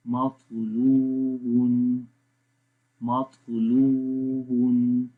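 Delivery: "मतलूबुन" slow, with "लू" stretched out; long waaw. Shown as a demonstration. Teacher models waaw madd for learners, "मतलूबुन" with elongated "लू". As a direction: hold the middle "लू" of "मतलूबुन". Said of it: "वाव को लंबा करेंगे".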